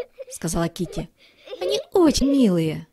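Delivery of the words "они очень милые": higher, giggly kitten voice; a sweet kitten gushing; cute girl kitten. A narrator's reading. Kitty praises monkeys. (милый голосок, выше, со смешком)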